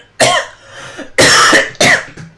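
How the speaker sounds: loud, heavy coughing fit; helpless, violent hacking cough; coughing his guts out. Real, not acted. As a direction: cough hard, in repeated bursts. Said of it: "coughing my guts out".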